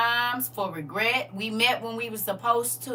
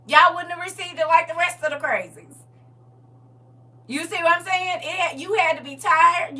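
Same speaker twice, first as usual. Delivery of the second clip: higher and louder, faster; raised, energetic preaching; rising preach; 8 semitones higher, 8 dB louder than usual. Real, not acted. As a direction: drive the point home. Preaching crescendo.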